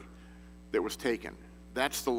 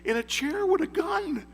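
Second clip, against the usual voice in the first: much higher, excited pilot on the radio; pilot radioing the tower. Retold or imitated. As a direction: sound like a stunned pilot calling in. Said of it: "He says".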